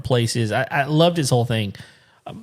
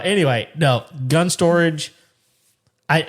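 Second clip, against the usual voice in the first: normal talking voice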